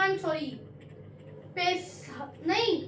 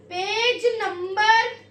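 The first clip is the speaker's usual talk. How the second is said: drawn-out dictation; 3 semitones higher, 4 dB louder, much slower than usual. Shown as a demonstration. Dictating homework slowly.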